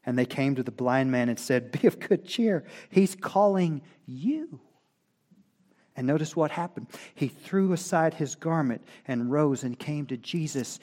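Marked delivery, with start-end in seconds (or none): 1.7-4.6 high, lifted voice bringing good news; cheering encouragement. Acted. Crowd tells blind man Jesus wants him, encouraging. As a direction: pitch it high and stretch out the ending.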